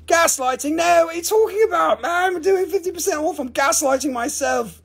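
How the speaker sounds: very high-pitched incredulous protest; mock indignation. Seller answering a gaslighting accusation, high mock indignation.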